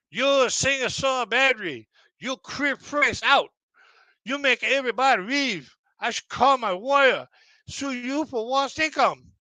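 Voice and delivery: high, slow, angry broken-English yell; imitating yelling owner. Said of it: "And he yelled at me".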